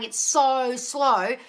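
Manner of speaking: mock whine, "It's so slow" drawn out very slowly; whiny complaint. Coach mimics a client moaning "It's so slow" with a slow drawn-out whine. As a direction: stretch "It's so slow" into a long slow moan. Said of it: "If you keep saying".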